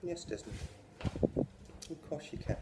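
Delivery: lightly voiced prim teacher; sweetly cruel teacher. Teacher toying with the desperate boy, prim. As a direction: drip false sweetness.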